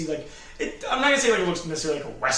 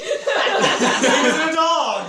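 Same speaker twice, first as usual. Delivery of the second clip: high, loud laughing yell; laughing shout; 12 semitones higher, 8 dB louder, much slower than usual. Real, not acted.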